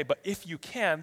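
usual speaking voice

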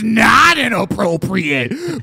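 slow, emphatic showman delivery; hyped up. Acted. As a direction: drag it out like a hype man. Hyped host rebuttal.

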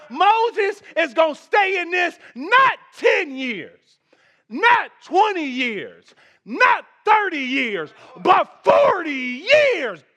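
slow, loud, high climb through the years; building preaching. Acted. Preacher to congregation, drawing out forty years in a loud climb.

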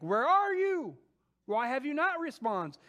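Habakkuk challenges a silent God, loud and pleading; confronting God. Retold or imitated. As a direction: call out to God in frustration. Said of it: "he really confronts God".

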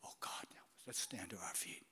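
hushed whispered invitation; whispered call to stand. Real, not acted. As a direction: breathe it out softly to the congregation. Closing the sermon softly.

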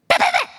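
high rapid imitated gunfire; mock paintball fire; paintball gun noise. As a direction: spit out the gun's rat-a-tat. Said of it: "that's the sound it makes".

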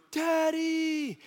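put-on high, drawn-out child's call; daughter calling out. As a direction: imitate a little girl calling across a room. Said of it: "all the way across the arcade going".

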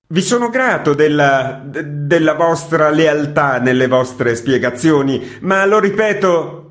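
high, loud indignant shout. A narrator's reading. Editor shouting after departing Gvozdev, loud and high. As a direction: shout after him, offended and shaken. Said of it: "gridò dietro a Gvozdev".

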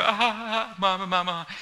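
put-on high squealing baby ah ah mama; a playful imitation of a baby; baby babble. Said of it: "And they go".

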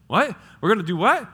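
high, rising bewildered question; puzzled kid's reaction. Retold or imitated. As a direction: pipe up like a baffled child. Kids' puzzled reply acted out.

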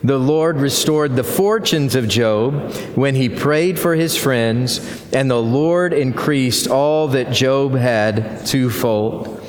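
congregation reading aloud together; many voices in unison. Real, not acted. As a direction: read it together as one body. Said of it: "we'll read it aloud together".

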